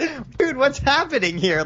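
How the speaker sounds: high, baffled what; mock bewildered. Voicing a baffled onlooker at the apology loop, high and bewildered. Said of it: "It's like".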